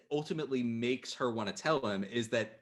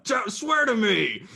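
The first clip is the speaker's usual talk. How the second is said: mock character voice; 9 semitones higher, 6 dB louder, slower than usual. Sudden put-on voice quoting.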